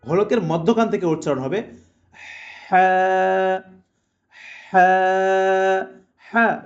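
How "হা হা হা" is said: "হা" from mid-throat, full of hot breath; "হা" as a strong Arabic h pushed from the throat; hot-air ha. Shown as a demonstration. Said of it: "গরম বাতাস জারি থাকবে".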